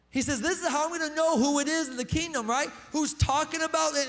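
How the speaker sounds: loud, high, building pulpit cry; preaching at full force. Acted. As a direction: drive it home to the congregation. Voices Paul, building to climax.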